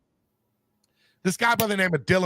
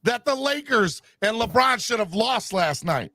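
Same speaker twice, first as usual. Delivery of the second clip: shouting; 8 semitones higher than usual. Real, not acted. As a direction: shout it out in disbelief. Ranting about Lakers loss.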